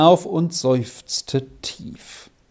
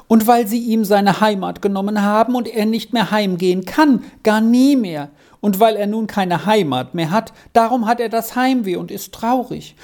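put-on higher, eager child's voice; child Squirrel, earnest; 6 semitones higher than usual. A narrator's reading. Squirrel tells the professor why Arthur is homesick, earnest child. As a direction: pour out the explanation like an earnest child.

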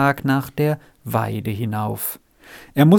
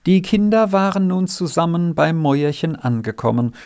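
ordinary delivery

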